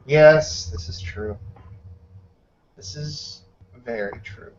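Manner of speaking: lightly voiced stranger, soft and hushed; unknown voice. A hidden stranger murmurs to the captain, quiet. Unseen speaker answers in another tongue.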